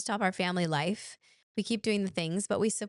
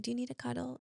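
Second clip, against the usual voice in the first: soft, higher, gentle question; tender offer; 5 semitones higher, 7 dB quieter, faster than usual. Retold or imitated. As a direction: ask softly like a caring dad. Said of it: "He always says".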